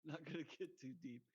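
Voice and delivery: usual speaking voice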